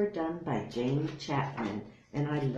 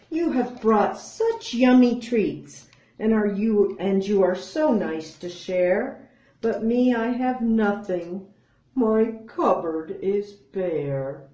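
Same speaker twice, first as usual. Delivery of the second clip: sad bear; 6 semitones higher, 6 dB louder than usual. Acted. Bear laments to friends.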